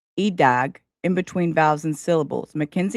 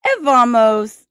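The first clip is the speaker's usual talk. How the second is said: loud exclamation; 5 semitones higher, 8 dB louder than usual. Real, not acted. Loud exclamation after sneeze.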